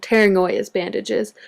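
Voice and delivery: ordinary delivery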